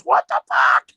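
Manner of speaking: put-on high-pitched bird shriek, like a girl; mock girly scream from a bird; girlish shrieking parrot. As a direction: screech it like a panicked parrot. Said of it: "Sounded like a girl".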